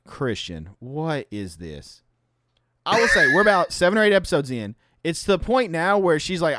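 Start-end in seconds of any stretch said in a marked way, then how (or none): 0.0-2.0 wife's flat question. Retold or imitated. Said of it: "she's just like".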